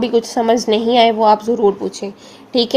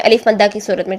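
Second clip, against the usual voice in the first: normal talking voice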